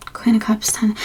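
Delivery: whispered under the breath; reading the card aloud to herself, whispered. Real, not acted. Tarot reader naming a card to herself, whispered.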